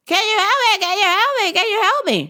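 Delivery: high, loud nagging plea; whiny customer. Retold or imitated. Pestering the clerk for help, high whiny nag.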